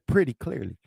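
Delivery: ordinary talk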